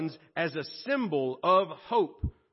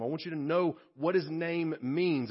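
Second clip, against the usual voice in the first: ordinary talk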